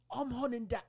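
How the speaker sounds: high, put-on hunter voice; retold tough hero. Trailer character declaring the hunt, high mock-dramatic. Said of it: "she's all like".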